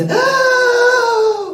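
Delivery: loud, high desperate gasp; big dramatic gasp; gasp for breath. Acted. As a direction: suck in air like someone suffocating. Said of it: "panting for breath".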